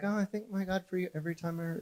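soft, quick, sugary thank-you voice; mock pleasantries. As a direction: breeze through it like a polite greeting. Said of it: "a tone of voice that is like".

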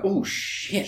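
imitating an audience stunned by an actor; awed whisper. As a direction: breathe it out, impressed. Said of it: "that makes everybody like".

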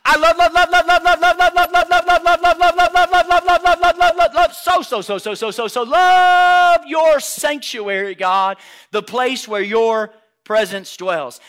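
put-on high, loud, rapid-fire repetition in a gushing child's voice; excited child. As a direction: gush like an overexcited kid, stretching the big words. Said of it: "Can I put that in a child term?".

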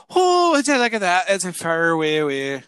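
lilting Irish-Scottish accent, starting high; attempted Irish accent, almost Scottish. Shown as a demonstration. Getting the word far down, Irish accent sliding Scottish. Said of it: "that was actually almost Scottish rather than Irish".

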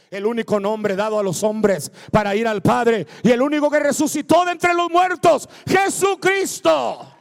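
loud proclamation climbing to a shout; full-force preaching. Real, not acted. Preacher proclaims the risen Christ to church, shouted preaching.

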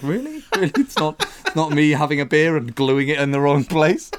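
a hint of a stunned carpenter; mock incredulity. Carpenter doubts boss blaming the product, mock incredulous.

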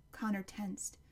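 usual speaking voice

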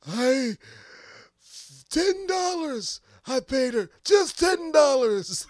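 yelled high and loud, dragging out ten dollars; mock Bernie shout. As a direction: bellow it like a cornered old senator. Bernie voiced, shouting about mistress.

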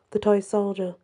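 ordinary delivery

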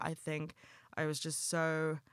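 usual speaking voice

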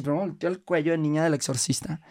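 ordinary talk

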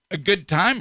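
hiccup breaking into the words. Real, not acted. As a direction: talk through a hiccup. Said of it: "excuse the hiccups".

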